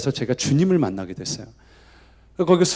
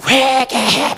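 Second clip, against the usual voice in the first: higher mock shout of repentance; preacher yelling at kids to repent, higher; preacher's yell; 8 semitones higher, much slower than usual. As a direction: play the wild-eyed preacher yelling. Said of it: "강사님 막 눈 뒤집고".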